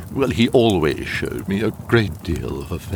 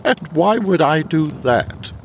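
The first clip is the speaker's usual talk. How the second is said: indignant; 8 semitones higher than usual. Acted. Indignant at scarf accusation.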